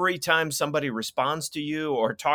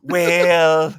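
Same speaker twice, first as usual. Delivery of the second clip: loud, higher, drawn-out impression; Ronald Reagan; 5 semitones higher, 9 dB louder than usual. Expat pundit starting his answer, hammed-up Reagan voice. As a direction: stretch it out in a comic presidential voice. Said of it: "I think he's Ronald Reagan".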